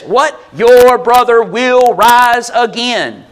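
mock proclaiming. Jesus tells Martha, slow and loud. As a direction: proclaim it slowly like a promise. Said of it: "he says".

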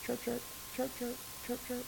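soft, high little chirping; cricket chirps. Retold or imitated. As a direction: mimic crickets in a hush.

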